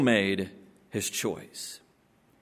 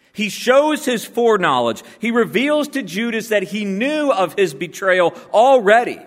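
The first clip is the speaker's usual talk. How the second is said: raised, ringing pulpit voice; full-force preaching; 7 semitones higher, 3 dB louder than usual. Acted. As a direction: preach it out to the whole room.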